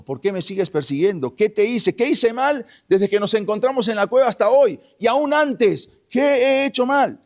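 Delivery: high, loud questioning, building; aggrieved protest. Retold or imitated. David asks Saúl why he persecutes him, aggrieved outcry. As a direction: plead innocence with mounting force and pitch.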